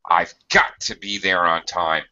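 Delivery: "got" punched and slightly held; extra oomph on the word "got"; stress on GOT. Shown as a demonstration. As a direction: lean hard on "got" to show obligation. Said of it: "throw in a little extra emphasis and intonation stress".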